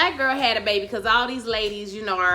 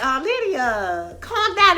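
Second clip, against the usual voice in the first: slow then high, loud pleading; mock pleading; 7 semitones higher, 5 dB louder, slower than usual. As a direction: beg her slowly, then cry out. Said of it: "you wasn't like".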